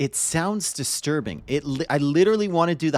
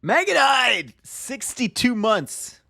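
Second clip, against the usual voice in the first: excited outburst; 10 semitones higher, slower than usual. Real, not acted. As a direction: blurt it out, thrilled. Excited outburst at stream event.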